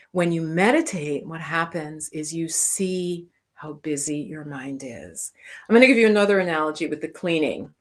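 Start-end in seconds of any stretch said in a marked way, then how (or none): 1.2-5.3 hushed, slow whisper, almost no voice; whispering the key point to the listeners. Real, not acted. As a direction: draw them in with a secret-like whisper. Making a point about the busy mind.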